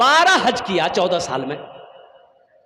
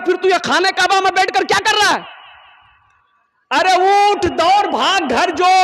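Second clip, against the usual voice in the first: urgent shout; 8 semitones higher than usual. Acted. Holy man urging the youth to run home, shouting. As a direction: drive him out the door with your voice.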